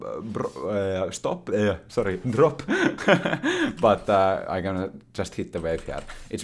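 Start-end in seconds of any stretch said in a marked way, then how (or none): 2.7-3.8 rising burst of laughter; high laugh. Real, not acted. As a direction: laugh at the slip. Laughs after misspeaking.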